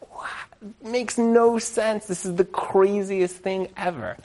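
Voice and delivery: breathy "What?", then high and slow; stunned disbelief. Retold or imitated. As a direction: reel from the shock. Ex-wife sees her ex-husband.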